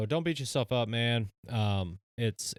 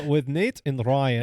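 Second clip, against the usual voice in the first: put-on slow, loud Russian-accented announcing; 5 semitones higher, 8 dB louder, slower than usual. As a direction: drag the names out in a Russian accent. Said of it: "I put on my Russian accent".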